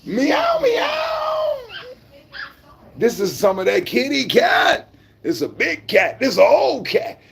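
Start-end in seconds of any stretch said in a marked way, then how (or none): 0.0-1.6 squeaky high mock meows; high falsetto cat imitation. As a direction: meow like a kitten, very high. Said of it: "You don't like meow?".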